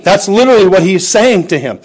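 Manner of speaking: normal talking voice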